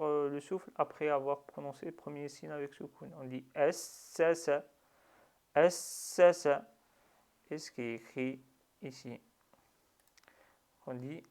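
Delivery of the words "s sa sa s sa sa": "S, sa, sa" with the first s held straight into the next, no breath between; doubled s, unbroken. Shown as a demonstration. Teacher demonstrating shadda on sin, "S, sa, sa" with the s doubled in one breath. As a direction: run "S, sa" together on one breath, leaning on the doubled s. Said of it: "sans reprendre le souffle, sans laisser partir".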